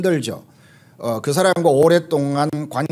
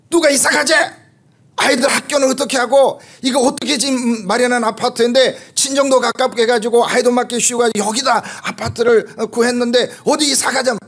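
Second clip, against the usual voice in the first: much higher and louder, a furious snapping rant; angry outburst; 10 semitones higher, 4 dB louder than usual. Retold or imitated. Tired wife lashing out at her husband after a long drive, angry high outburst. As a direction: let it burst out high and hot, like a fed-up spouse.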